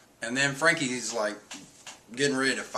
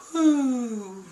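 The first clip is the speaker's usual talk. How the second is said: relieved whew; 12 semitones higher than usual. Retold or imitated. Relieved reaction quote.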